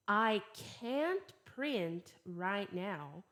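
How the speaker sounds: very slow, clear teacher model; slow repeat-after-me drill; slow model sentences. Shown as a demonstration. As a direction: say each sentence slowly for students to repeat. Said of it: "Repeat after me".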